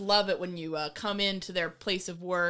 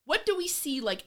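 ordinary talk, nothing marked

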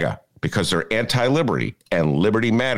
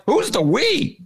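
incredulous retort; slower than usual. Retold or imitated. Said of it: "And I'm like".

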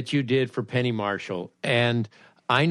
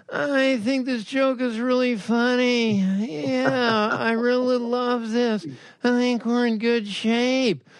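higher, slow, mumbling whine; Marshall family whine; 8 semitones higher, slower than usual. Retold or imitated. Writers at Gracie Films imitated in the family's slow high whine. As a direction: whine it lazily like a writing-room Marshall. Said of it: "they would be going".

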